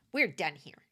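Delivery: a mild snarky brush-off; snarky dismissive. Retold or imitated. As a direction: cut them off with light disdain. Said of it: "kind of snarky, dismissive".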